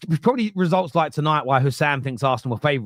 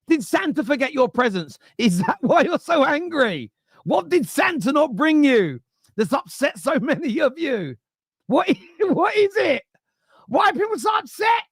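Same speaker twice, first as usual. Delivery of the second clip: high-pitched, exaggerated childish complaint; mock-upset whining; 13 semitones higher than usual. Streamer teasing angry commenters about missing Santa presents, mock-upset. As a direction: plead in a hammed-up high voice, mocking them.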